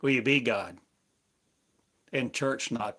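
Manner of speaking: ordinary talk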